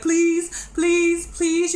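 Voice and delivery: high, whiny pleading imitation; lightly mocking plea; mock begging. Mocking Benny begging on phone.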